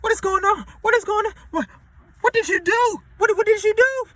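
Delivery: high, loud hammed-up panic; panicked yelling. Panicked passenger yelling at the other one on the sinking ship.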